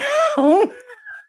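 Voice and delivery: rising squeal of laughter; high laugh. Real, not acted. Laughing at chat fight.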